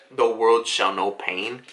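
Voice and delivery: deep, slow villain voice; Pain. Retold or imitated. Floating above Konoha with arms out, deep slow voice. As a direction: intone it low and drawn out. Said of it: "deep-ass voice".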